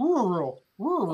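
mumbled, one-lump "Rural" for Louisville; swallowed Louisville attempt. Shown as a demonstration. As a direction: practise a swallowed "Rural", all vowel and no consonants. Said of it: "coming from way in the back".

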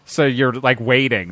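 normal talking voice

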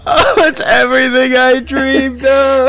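high, slowed, sobbing delight; mock tearful joy. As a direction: gush like a dream came true.

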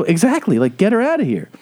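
high-pitched indignant comeback; retold exasperated retort. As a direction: re-enact being fed up with excuses. Retold exasperated reply to excuses.

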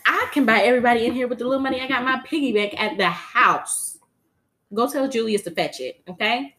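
put-on loud, high spoiled child; bossy rich child. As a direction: brag about money to her father, loud and high. Blue voiced at auction.

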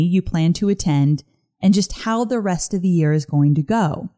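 ordinary delivery

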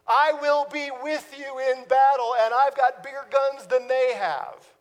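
rally him like help has arrived; confident battle promise. Retold or imitated. Friend bringing an army, loud rallying promise. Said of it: "somebody else comes up and says".

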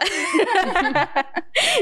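embarrassed giggle; high laugh. Real, not acted. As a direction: laugh at yourself. Laughing at her own jealousy.

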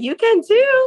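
high, drawn-out "too"; sing-song comeback to a friend; mock playful retort. Mock quote to friend, sarcastic.

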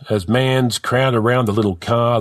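ordinary delivery

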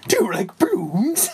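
high, teasing clown; creepy clown voice. Pretend. Clown offering balloons to a victim, high mock-creepy voice. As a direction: put on a high, playful-creepy voice. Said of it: "That was a good voice there".

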